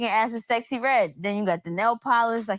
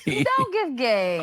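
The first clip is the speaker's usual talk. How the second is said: laughing; 2 semitones higher, slower than usual. Real, not acted. Repeated joke with laugh.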